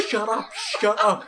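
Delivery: high, loud laughing shush; laughing hush. Retold or imitated. Telling coworker to shut up, laughing high. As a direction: hush him through your own laughter. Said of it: "And I'm like".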